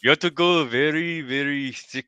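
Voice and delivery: high, loud mock Japanese accent; thick imitated Japanese accent. Friend showing how thick to go, mock Japanese accent. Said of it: "that's the kind of way it sounds to them".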